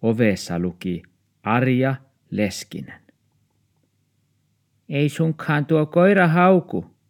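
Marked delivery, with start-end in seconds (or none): 4.9-7.0 put-on high, loud old woman's voice. A narrator's reading. Downstairs old woman asks about the dog, high and loud. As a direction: pitch up into a fussy old neighbour. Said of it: "Arja-muori kysyi".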